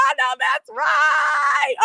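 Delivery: high, slow delighted shout; cheering shout. Real, not acted. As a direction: holler your approval up high. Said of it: "I hollered, girl".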